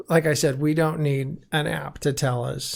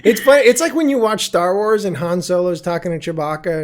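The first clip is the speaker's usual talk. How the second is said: usual speaking voice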